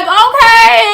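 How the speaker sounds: laughing, high and loud; laughing okay. Retold or imitated. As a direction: laugh it off loudly. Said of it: "I just laughed".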